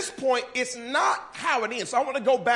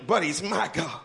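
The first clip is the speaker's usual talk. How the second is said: normal talking voice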